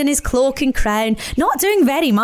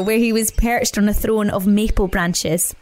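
ordinary delivery

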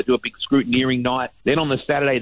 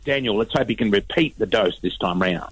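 normal talking voice

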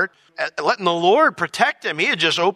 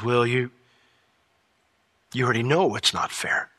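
low, soft, tender answer; calm him with plain truth; gentle reply; 6 semitones lower, 4 dB quieter than usual. Retold or imitated. The Lord answering Will gently.

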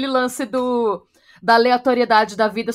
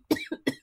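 sharp choking cough; 9 dB quieter than usual. Real, not acted.

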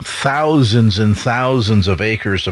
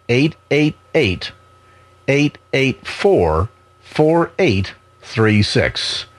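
each digit said slowly and crisply; over enunciating every digit; slow and deliberate; much slower than usual. Shown as a demonstration. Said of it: "one more time slowly".

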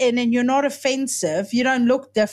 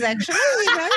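laughing; 5 semitones higher, much faster than usual. Real, not acted. Joking along, laughing.

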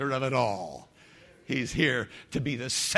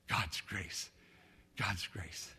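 quiet; 5 semitones lower, 12 dB quieter, slower than usual. Acted. Soft repetition.

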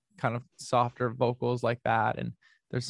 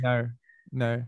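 put-on Australian "No" with an R-like ending; Australian accent, R tacked onto the vowel. Shown as a demonstration. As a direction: say "No" Aussie style, as if ending in R. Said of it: "just kinda put an R at the end".

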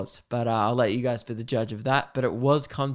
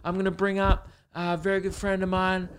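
rock star announcing; 6 semitones higher than usual. Retold or imitated. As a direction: hype up the crowd and introduce her. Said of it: "And he's like".

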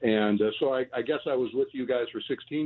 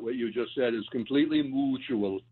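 ordinary talk, nothing marked

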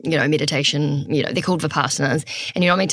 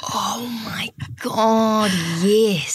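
drawn-out breath, then high-pitched realisation; real astonished recall; 6 semitones higher, slower than usual. Real, not acted. Reminded of the shocking retreat in Australia, astonished. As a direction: gasp it out slowly as the memory lands.

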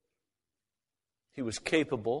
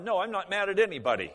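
higher, louder, faster irritated denial; getting angry; 7 semitones higher, 4 dB louder, faster than usual. Retold or imitated. Peter denying his anger to the pastor, heated. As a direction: snap back "No, I'm not mad at anybody" fast and heated. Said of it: "he got madder as he talked".

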